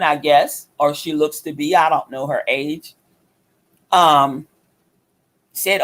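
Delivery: usual speaking voice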